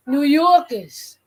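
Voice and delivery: New Yorkers slow, as "Noo Yaw-kuhz"; New York pronunciation. Shown as a demonstration. Showing a friend the sound, New Yorkers as drawn-out "Noo Yaw-kuhz". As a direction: stretch Yorkers with a rounded "aw". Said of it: "you see how I said New Yorkers?".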